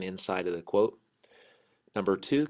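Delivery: usual speaking voice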